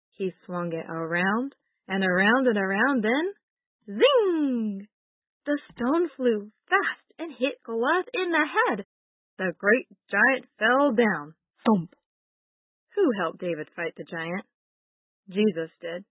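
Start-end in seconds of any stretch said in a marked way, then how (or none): 3.8-4.9 sound effect. A narrator's reading. Sling sound effect in story. 11.6-11.9 sound effect. A narrator's reading. Giant falls, sound word.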